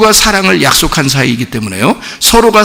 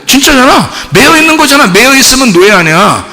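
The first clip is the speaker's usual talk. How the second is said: normal talking voice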